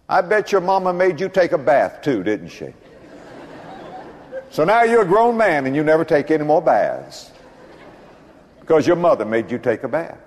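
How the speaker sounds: ordinary delivery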